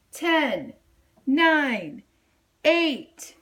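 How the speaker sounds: put-on boy counting down; countdown. A narrator's reading. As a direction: count down with growing urgency. Mission control countdown.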